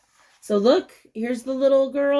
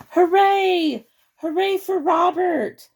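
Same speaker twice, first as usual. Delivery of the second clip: cheering; 6 semitones higher, slower than usual. A narrator's reading. Townsfolk cheering Robert for stopping robbers, excited cheer. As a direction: cheer like a whole crowd. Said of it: "Everyone yelled".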